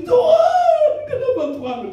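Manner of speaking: squealing high, hammed-up sob to God; mock crying. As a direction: cry out like a new widow, played for laughs. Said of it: "Lalu dia menangis".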